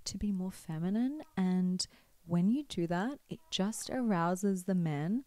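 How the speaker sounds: mock breathy advice. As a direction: parody a breathy seductive adviser. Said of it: "some people say, "Oh, you have to speak like this".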